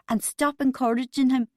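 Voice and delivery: sharp reprimand; barked. A narrator's reading. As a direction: bark the order at a colleague. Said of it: "she barked at Bubbly".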